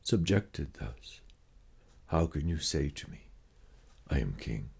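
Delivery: usual speaking voice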